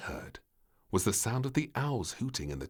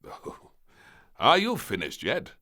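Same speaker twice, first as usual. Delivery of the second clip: louder, clipped impatience; impatient; 7 semitones higher, 7 dB louder than usual. Acted. The King waiting on Princess, loud and impatient. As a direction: hurry her along, fed up. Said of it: "said the King impatiently".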